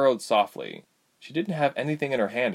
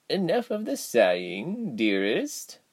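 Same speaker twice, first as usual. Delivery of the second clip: lightly voiced gentle old woman; a hint of an old governess; elderly woman voice; 5 semitones higher, slower than usual. A narrator's reading. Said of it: "the elderly woman requested".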